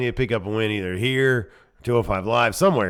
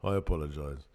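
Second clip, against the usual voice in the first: put-on British accent; 8 semitones lower, 6 dB quieter than usual. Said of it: "doing this British accent every single goddamn time".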